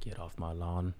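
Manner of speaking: hint of a grumpy Clint Eastwood, said under the breath; cranky old man. Friend joking about an old man yelling at kids, soft mock grumble. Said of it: "He's like Clint Eastwood".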